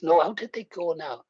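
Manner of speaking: ordinary delivery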